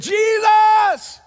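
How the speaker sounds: huge, high, sustained shout; crying out. Acted. Blind beggar calling Jesus as he passes, full-throated cry. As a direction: holler the name to the back of the room. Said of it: "I think I woke somebody up".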